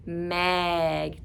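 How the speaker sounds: ordinary talk, nothing marked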